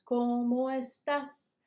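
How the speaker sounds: put-on drawn out and lifted for kids to copy; teacher modeling; slow model question. Shown as a demonstration. Teacher asking the class slowly, drawn out. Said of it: "Let's try it again".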